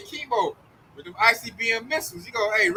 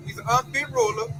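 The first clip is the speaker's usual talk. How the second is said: usual speaking voice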